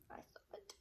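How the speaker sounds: soft unvoiced whisper. A narrator's reading. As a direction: breathe it secretly. Hushed narration.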